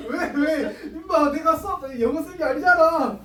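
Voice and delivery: loud, high-pitched playful outburst; laughing protest. Real, not acted. Teacher defends his English to teasing students, laughing protest. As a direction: protest loudly through laughter.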